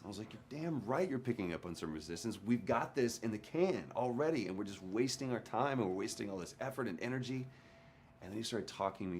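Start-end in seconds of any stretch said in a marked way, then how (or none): 0.3-2.4 higher-pitched, fed-up comeback; mildly heated retelling of snapping back; retold angry retort. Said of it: "I'm getting madder and madder". 2.4-7.5 higher-pitched, riled protest; mildly heated retelling of an outburst; retold exasperation. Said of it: "I'm getting madder and madder".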